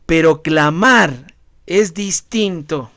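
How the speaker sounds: normal talking voice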